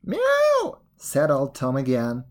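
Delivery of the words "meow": high, drawn-out cat cries; cat meows. Acted. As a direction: meow like a cat, high and long. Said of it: "they cried all together".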